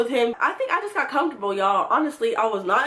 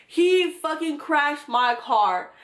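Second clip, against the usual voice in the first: ordinary talk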